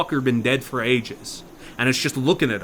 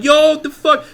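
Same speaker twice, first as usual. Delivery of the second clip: startled yelp, much higher and louder; 13 semitones higher, 8 dB louder, slower than usual. Acted. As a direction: jump at it. Table flies at them, startled.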